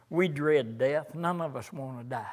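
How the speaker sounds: ordinary talk, nothing marked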